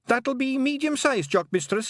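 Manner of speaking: high, loud Scots pixie voice, eager to please; a wee Scottish pixie chirping up to Tiffany; Feegle pixie voice. Acted. Pixie telling Tiffany his long name, high and eager.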